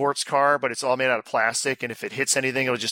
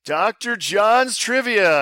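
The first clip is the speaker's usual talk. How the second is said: raised, drawn-out title reveal; game-show announcement; 7 semitones higher, 4 dB louder, much slower than usual. Acted. Host unveiling the quiz's name, announcer-style. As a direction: announce it big and slow.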